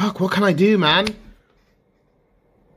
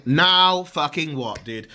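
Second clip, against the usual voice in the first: ordinary delivery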